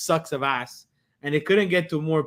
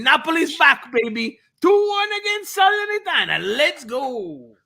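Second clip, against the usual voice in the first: excited celebration; 11 semitones higher, 4 dB louder than usual. Real, not acted. Celebrating a late Napoli win.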